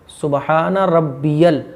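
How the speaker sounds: रब्बियल very slow with a clear यल ending; slow, clear Rabbiyal. Shown as a demonstration. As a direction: draw out रब्बियल so the 'ya' is heard. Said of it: "रब्बिल नहीं". (रब्बियल धीरे, 'यल' साफ़ बोलकर)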